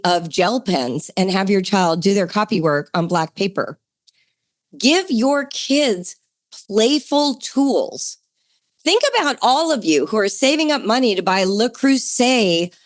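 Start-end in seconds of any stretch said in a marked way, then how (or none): 4.8-8.2 slow, every word weighted and a little higher; slow emphatic advice. Real, not acted. Host urging parents, slow deliberate emphasis. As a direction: land the key point slowly.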